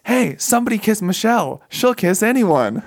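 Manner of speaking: high calling voice from the crowd. Retold or imitated. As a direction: egg them on from the crowd, high.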